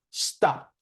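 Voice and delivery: "stop" closed with an added T, stopped; T-ending demo. Shown as a demonstration. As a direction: model the voiceless T after the P in "stop". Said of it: "you will get a T sound".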